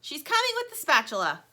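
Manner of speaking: high, loud reassuring shout; yelling. Acted. Plastic yells to Lumpy that help comes. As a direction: shout it out to the yard. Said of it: "yells Plastic".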